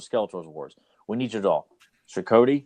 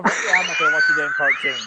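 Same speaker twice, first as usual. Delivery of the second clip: mock shout. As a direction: blurt it out in a squeaky yell.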